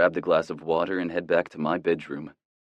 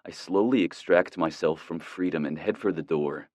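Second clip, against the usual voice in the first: plain reading voice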